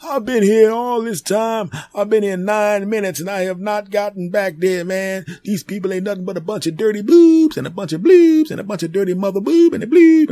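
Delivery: put-on high, loud, cursing old lady; shrill furious ranting old woman; foul-mouthed granny. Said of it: "people on the phones cursing out the nurses".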